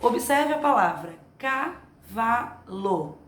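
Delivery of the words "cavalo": "cavalo" in three separate slow syllables. Shown as a demonstration. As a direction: break "cavalo" into ca, va, lo with clear pauses. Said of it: "Vocês percebem os pedacinhos que ela tem".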